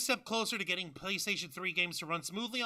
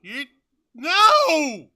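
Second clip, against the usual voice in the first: loud, high panicked shout of no; 8 semitones higher, 16 dB louder than usual. Real, not acted. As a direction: yell no in alarm. Reacting to the paid-again idea.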